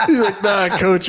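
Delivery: high laughter spilling into the quote; laughing. Real, not acted. Retelling the kid's weigh-in excuse, laughing high.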